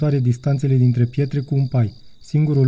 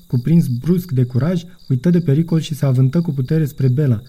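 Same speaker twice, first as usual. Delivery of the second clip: usual speaking voice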